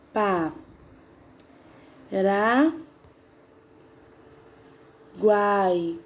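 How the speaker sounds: Pa, ra, guai with long pauses between the syllables. Shown as a demonstration. Teacher splitting Paraguai into syllables for pupils, slow and spaced. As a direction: split Paraguai slowly into three beats. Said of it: "Paraguai tem um final igual do Uruguai".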